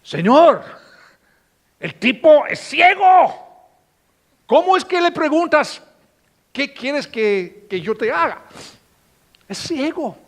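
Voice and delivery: high, loud disbelief; protest, astonished, that it's obvious; incredulous protest. Pretend. Disciples questioning Jesus, high incredulous shouting. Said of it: "los discípulos están pensando".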